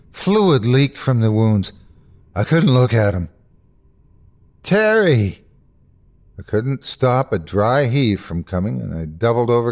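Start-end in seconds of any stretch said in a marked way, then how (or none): 4.6-5.4 horrified cry. Acted. Horrified cry at friend's eyes.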